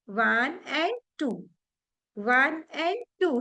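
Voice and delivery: drawn-out slow count of वन and टू. Shown as a demonstration. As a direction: count वन and टू slowly, each word stretched. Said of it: "पहले slow करेंगे".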